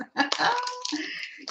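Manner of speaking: high, soft peal of laughter; real laugh. Real, not acted. Amused by the sound glitch.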